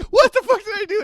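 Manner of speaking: high, loud bewildered protest; confused whine. Retold or imitated. Jeff protesting the scolding, high and bewildered. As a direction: squeal it in baffled outrage. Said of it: "And he's like".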